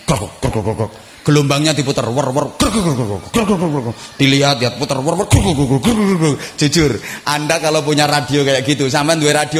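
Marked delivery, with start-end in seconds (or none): 0.0-0.9 low crackling radio noise; imitated radio static. 2.1-4.0 whirring dial, then crackles; imitated tuning static. Tuning a crackling broken radio. 4.8-6.4 whirring dial, then crackles; imitated tuning static. Tuning a crackling broken radio.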